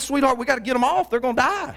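mock panic. Panicked plea to wife.

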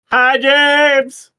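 loud high call to another room; yelling across the room. Real, not acted. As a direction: shout his name through the house. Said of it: "now I just yelled across the house".

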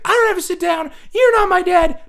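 high whiny sixth grader talking back; whiny defiant kid. Retold or imitated. Kid refusing to sit, high whiny back-talk. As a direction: sass the teacher in a high kid voice. Kid defies teacher in class.

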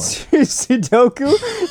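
slow, drawn-out incredulous question. Real, not acted. Friend baffled by the wrong word, drawn-out disbelief. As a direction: stretch it out in disbelief. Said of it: "You mean seppuku?".

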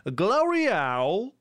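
Owl said like the English bird word, drawn out slowly; slow careful Glory Owl. Shown as a demonstration. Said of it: "comment on prononce".

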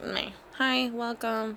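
slow, quiet half-hearted greeting; mock uninterested. Store clerk greeting customers without wanting to be there, mock uninterested. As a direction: greet like underpaid staff who don't care. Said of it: "They're uninterested".

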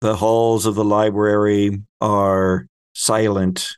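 ordinary delivery

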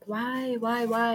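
high, pleading, repeated why. Retold or imitated. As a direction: beg the question like a hurt child. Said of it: "And you're like".

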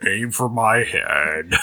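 slow, resigned, mopey drawl; gloomy Eeyore voice. Pretend. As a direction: sound utterly defeated and glum. Eeyore resigned to being shot.

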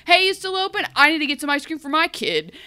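high, loud hurried call; bursting in. Retold or imitated. Emma bursting into the ice cream shop, loud and high. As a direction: burst in calling out for service.

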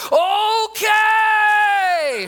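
a party-starter yell from the back row. Retold or imitated. Passenger behind him whooping as the plane drops, hype shout. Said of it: "Is [name] on this plane?".

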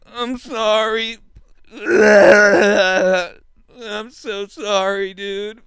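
high, slow, crying apology; mock sobbing apology. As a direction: wail like a sick friend begging forgiveness. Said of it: "he's just like".

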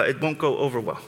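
ordinary talk, nothing marked

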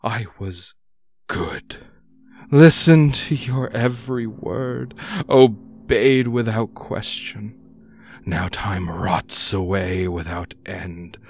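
wavering lament of a lake creature; mournful, echoing plea; quavering monster voice. Acted. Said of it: "A quavering voice echoes off the cavern walls".

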